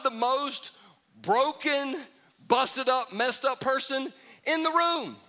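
high preached emphasis. Real, not acted.

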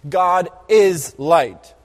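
slow, weighty stress on each word; slow emphatic. Acted. Preacher to congregation, slow weighty emphasis. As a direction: lean into it slowly and let it land. Preacher repeating key phrase.

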